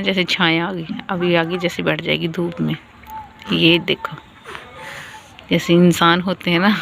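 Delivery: usual speaking voice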